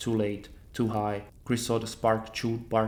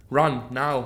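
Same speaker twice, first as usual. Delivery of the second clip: louder urgent snap; snapped; 3 semitones higher, 7 dB louder than usual. A narrator's reading. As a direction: order her off with force. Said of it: "he snapped".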